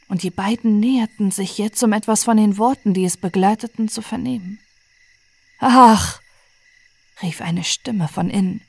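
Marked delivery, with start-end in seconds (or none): 5.6-6.2 hoher, lauter Klageruf; klagendes Ach. Acted. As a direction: ein gequältes Ach ausstoßen. Klagender Gefangener ruft von innen. (gequälter Klageruf)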